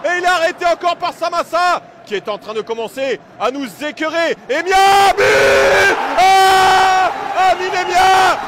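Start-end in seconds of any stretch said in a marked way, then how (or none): 4.5-8.4 loud, long goal shout; excited sports commentary. Real, not acted. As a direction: let out a huge goal roar. Goal call in live commentary.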